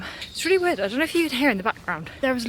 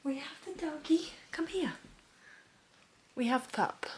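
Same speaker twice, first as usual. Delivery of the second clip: hushed; 11 dB quieter than usual. Real, not acted. Late at night, dog home.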